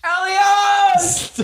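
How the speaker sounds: hammed-up scream across the arena; calling out Elios. Calling out to friend.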